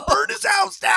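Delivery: high, loud hammed-up yell; mock mob shout. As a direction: shout like an angry mob with torches. Angry neighbors mob.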